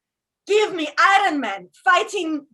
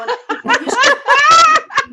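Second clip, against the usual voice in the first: laughing; 10 semitones higher, 12 dB louder than usual. Real, not acted. Comic cracks up mid-joke.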